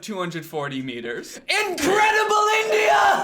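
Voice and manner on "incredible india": big high shouted slogan. Acted. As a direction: belt it like an ad tagline.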